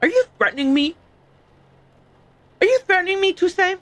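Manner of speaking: high-pitched affronted challenge; mock indignation. Mock indignation at game character.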